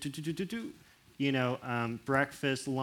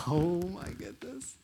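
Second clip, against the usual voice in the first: touched; 5 semitones higher, slower than usual. Retold or imitated. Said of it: "It's like".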